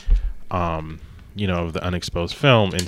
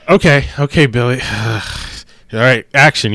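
weary director; 5 semitones higher, 9 dB louder than usual. Retold or imitated. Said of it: "he's like".

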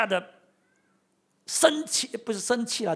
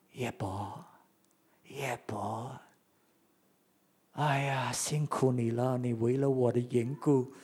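much softer, lower, gentle comforting voice; imagined tender God; 4 semitones lower, 3 dB quieter than usual. Pretend. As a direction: soothe him like a tender father. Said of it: "我们想象的神". (低沉轻柔、温情安慰的神)

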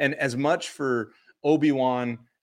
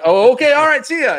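high, loud, hammed-up yelp; flustered agreement; 13 dB louder than usual. Fan hurried off by his friend, loud flustered yelp. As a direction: give in loudly in a high panicked voice.

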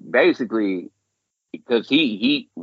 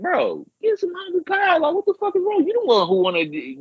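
much higher, incredulous telling-off; exasperated scolding. Retold or imitated. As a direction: scold him like a fed-up friend. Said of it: "And I'm sitting there like".

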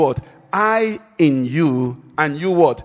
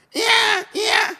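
put-on high squealing yeah of a woken child; child's wail. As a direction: squeal like a child jolted awake. Child wakes when put on bed.

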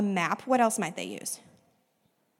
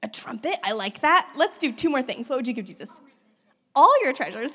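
usual speaking voice

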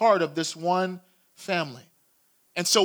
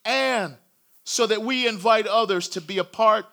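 rising, louder preacher's appeal; preaching call; 4 semitones higher, 5 dB louder than usual. Real, not acted. Pastor calling listeners to join God's family, preaching at full force. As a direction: press the invitation home to the congregation.

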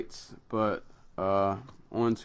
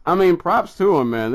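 excited; 8 semitones higher, 9 dB louder than usual. Real, not acted. Excited praise of the con.

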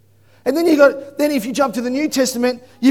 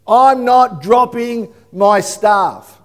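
ordinary talk, nothing marked

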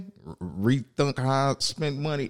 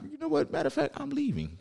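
high-pitched, softer retold huff; mock huffy exit; 6 dB quieter, faster than usual. Son walking off from mad dad, mock huff. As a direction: announce it in a comic high voice, quickly.

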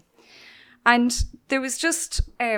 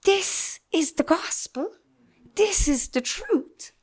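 slow, solemn divine voice, lightly voiced; voice of the Lord; 6 semitones higher, slower than usual. God speaking to the preacher in church, a slow solemn hint. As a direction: deliver it slowly as God speaking, without pushing it. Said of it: "what the Lord said to me".

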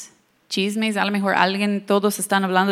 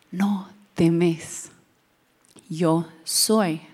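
whispered reassurance; 3 semitones lower, much slower than usual. Acted. Jesus speaking to the disciples.

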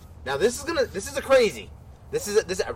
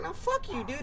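snapped back pitched way up; mock angry retort; 14 semitones higher, 6 dB quieter than usual. Telling off Diesel in the dream, high mock snap. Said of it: "And I was like".

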